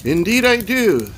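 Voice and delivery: projected, high-pitched reply; second man. A narrator's reading. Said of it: "said the second man".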